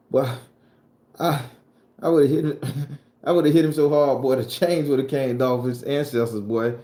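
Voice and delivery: loud, high boastful threat; hollering a comic threat; mock tough yell. Brag to the crowd about hitting him, loud mock yell.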